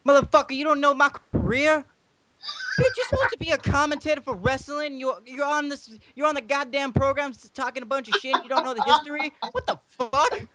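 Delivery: high-pitched mock outrage; hammed-up, high indignant tirade; indignant rant. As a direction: chew out an ignorant commentator in character. Teddy Long character berates the co-host.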